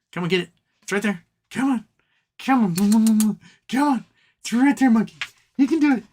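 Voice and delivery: building pet coaxing. Real, not acted. Owner urging the pet to grab it, building excitement.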